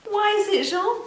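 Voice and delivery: high excited why; high playful question. Acted. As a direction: ask the puppet brightly. Teacher asks puppet.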